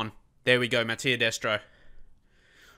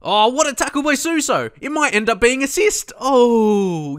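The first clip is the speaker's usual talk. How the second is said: much higher and louder, near a shout; thrilled outburst; 12 semitones higher, 10 dB louder than usual. Real, not acted. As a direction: celebrate the big tackle. Tackle leads to a chance.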